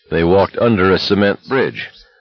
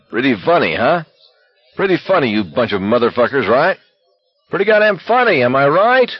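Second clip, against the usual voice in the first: worked-up taunt climbing in pitch; angry taunting; 6 semitones higher than usual. A narrator's reading. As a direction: jeer at them, pitching higher and higher. Enraged man taunting other walkers.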